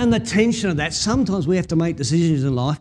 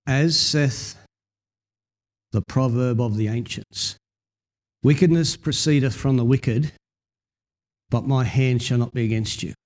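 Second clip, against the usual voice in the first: King James reading; 7 semitones lower than usual. Shown as a demonstration. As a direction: read it like the old King James Bible. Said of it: "old King James Version says".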